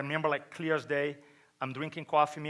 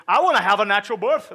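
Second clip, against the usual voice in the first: loud, higher imitation of wife; emphatic retold declaration; 4 semitones higher, 10 dB louder, faster than usual. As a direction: proclaim it loudly as the wife.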